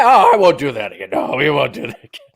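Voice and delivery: apologizing through laughter; mock apology. Uncle pretending to be sorry to parents, laughing. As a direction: promise it while giggling. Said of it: "It's my".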